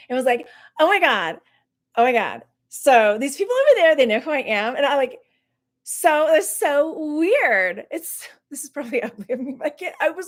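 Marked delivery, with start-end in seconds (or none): 0.8-7.9 higher-pitched, mock-excited gushing. YouTuber running over to boast to a group, mock excited. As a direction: put on a giddy, self-important brag. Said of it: "like, all excited".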